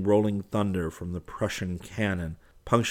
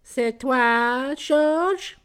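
very high, loud feminine voice; young woman calling; 6 dB louder, much slower than usual. A narrator's reading. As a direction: call out to a sweetheart in falsetto.

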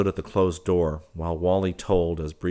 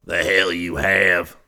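put-on slow, scornful old rancher snarl; old man's snarl; 8 dB louder, slower than usual. A narrator's reading. Bryant snarls at his fawning nephew. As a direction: drag out the contempt. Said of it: "snarled Bryant".

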